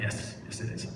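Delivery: half-whispered, under the breath; retold furtive murmur; hushed reply. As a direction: answer quietly so nobody else hears. Furtive reply to wife in church.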